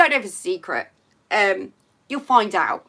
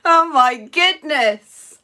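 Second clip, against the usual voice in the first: slow words through real laughter; giggling it out, amused at herself; laughing; 4 semitones higher, 5 dB louder, much slower than usual. Real, not acted. Laughing after the light change.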